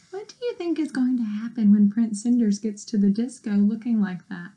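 plain reading voice

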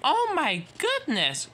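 high-pitched exclamation; astonished cry. Acted. Emily sees the engines rush in and cries out. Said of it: "cried Emily".

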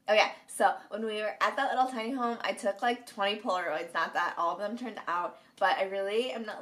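normal talking voice

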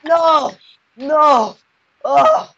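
high, loud cries of alarm; panicked cries. Real, not acted. Gamer crying out to friends while falling into lava, panicked. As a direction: cry out as the lava comes.

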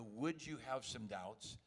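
would you have some doubts, drawn out slowly and softly; slow, drawn-out add-on. Shown as a demonstration. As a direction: let it trail in slow and low. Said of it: "And I add the".

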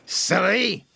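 high, huffed exclamation; huffy. A narrator's reading. Gordon, covered in mud, huffs crossly. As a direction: huff it out crossly. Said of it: "huffed Gordon".

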